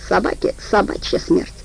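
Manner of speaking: plain reading voice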